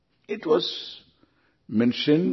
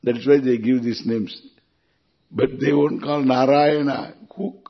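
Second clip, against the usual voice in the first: ordinary delivery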